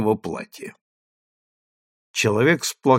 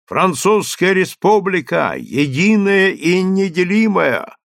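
town crier; 6 semitones higher than usual. A narrator's reading. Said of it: "Он начал громким голосом".

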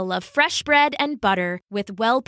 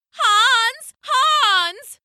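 high, loud, drawn-out calling; panicked cry; 13 semitones higher, 4 dB louder than usual. Acted. Haddo fleeing the mice, calling Hans in panic. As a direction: wail the name far higher than speech. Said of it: "Haddo cried".

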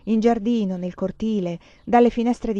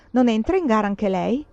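ordinary delivery, nothing marked

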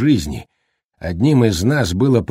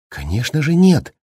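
indignant, higher-pitched protest; heated indignation; 6 semitones higher than usual. A narrator's reading. Wife objecting to the accusation against her husband, heatedly indignant. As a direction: protest hotly, lifting the pitch. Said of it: "горячо возмутилась Уэнди".

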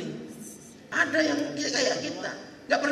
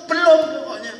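emphatic denial; 2 semitones higher, 11 dB louder than usual. Acted. Pilgrim snaps back a denial.

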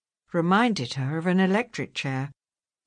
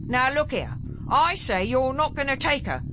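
higher, defiant warning; threatening; 7 semitones higher than usual. A narrator's reading. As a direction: square up and refuse to back down.

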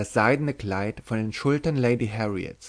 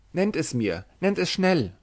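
urgent plea, leaping higher on the repeat; anxious cry; 6 semitones higher than usual. A narrator's reading. Harriet begs Kitty for the means of escape, anxious cry. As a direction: beg anxiously, with rising urgency. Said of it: "rief Harriet zitternd".

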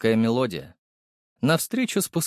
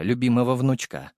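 plain reading voice

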